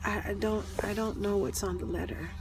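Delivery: calm her down softly. Retold or imitated. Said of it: "the same way I'm telling all of you right now".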